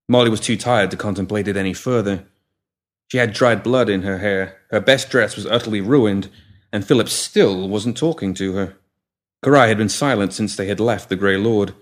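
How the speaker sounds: usual speaking voice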